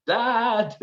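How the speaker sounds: high-pitched, drawn-out mock cry; retold shout. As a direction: imitate a helpless call for dad. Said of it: "I was shouting".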